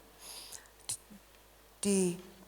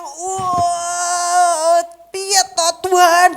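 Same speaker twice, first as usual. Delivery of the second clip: loud, higher, slow anguished cry; half-acted anguished cry to God; desperate outcry; 7 semitones higher, 9 dB louder, much slower than usual. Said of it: "seperti orang yang".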